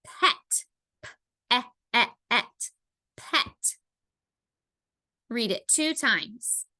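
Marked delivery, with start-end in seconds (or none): 1.0-2.7 pet split into p-, e-, et; segmented phonics demo of p-, e-, et; sounded out. Shown as a demonstration. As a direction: isolate p-, repeat short e-, then et with gaps. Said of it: "same vowel sound that you hear".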